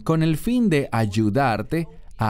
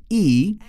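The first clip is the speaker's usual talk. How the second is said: "y" said louder and higher, set apart; stressed conjunction; 6 semitones higher, 4 dB louder than usual. Shown as a demonstration. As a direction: punch the single word "y". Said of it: "la conjunción".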